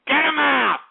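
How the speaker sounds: sudden loud bark, pitch jumps up; bouncer-style shout at a troll; get him out shout. Real, not acted. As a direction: throw him out with a yell. Host ejecting a troll guest.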